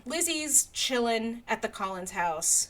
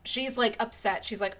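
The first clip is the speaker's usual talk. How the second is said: usual speaking voice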